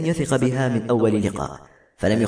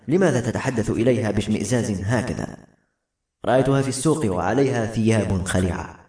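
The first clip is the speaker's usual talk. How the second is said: ordinary delivery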